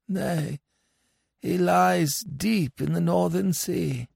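high, measured voice of the dead; mournful dead villager. Acted. Dead man tells father of drowned son, high mournful telling. Dead friend recounts son's fate.

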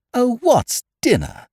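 high, drawn-out dismissal; impatient. A narrator's reading. Hastings dismisses his friend's worry about dinner, impatiently. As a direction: brush it off with slow, high-pitched exasperation. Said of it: "I said impatiently".

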